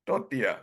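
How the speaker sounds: tortilla said tor-tee-ya, softly; gentle correct tortilla; tortilla, silent L. Shown as a demonstration. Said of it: "Should be pronounced".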